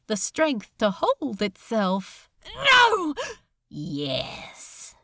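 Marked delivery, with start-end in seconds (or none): 2.5-3.1 high, loud desperate no; desperate terrified shout; terrified cry. A narrator's reading. Crowley resisting in terror. 3.7-4.9 low, soft buzzing yes; low buzzing gloat; buzzing demon. A narrator's reading. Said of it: "buzzed Beelzebub".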